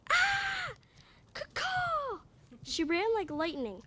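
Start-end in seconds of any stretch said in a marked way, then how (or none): 0.1-2.2 high, loud shrieking bird cry; hawk screech. Acted. The mama hawk screeches as she dives at Lex.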